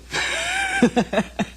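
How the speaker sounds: brief amused laugh; a short, real chuckle. Real, not acted. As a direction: let out a quick laugh at your own remark. Laughs after "so I know.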